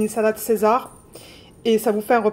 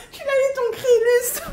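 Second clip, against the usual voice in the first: high, laughing squeal; 11 semitones higher than usual. Real, not acted. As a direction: laugh it out to the sender. (voix aiguë et rieuse)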